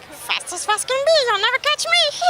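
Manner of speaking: very high, squeaky cartoon critter voice; jackalope cartoon voice. Quoting the jackalope from America's Funniest Home Videos in a high squeaky voice. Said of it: "this thing that was like".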